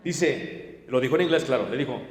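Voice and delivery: ordinary talk, nothing marked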